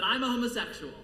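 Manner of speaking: loud, high announcement, retold; blurted confession. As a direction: blurt it out to the principal.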